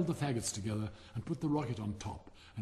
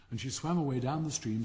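ordinary delivery, nothing marked

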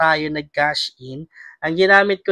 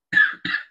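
short real chesty cough; one sharp cough mid-sentence. Real, not acted. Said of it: "Inuubo talaga".

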